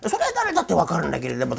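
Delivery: normal talking voice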